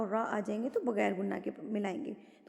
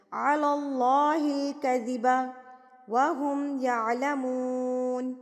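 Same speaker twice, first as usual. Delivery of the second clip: slow, drawn-out tajweed recitation; measured Quranic recitation; slower than usual. Acted. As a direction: chant it slowly and evenly. Recites verse.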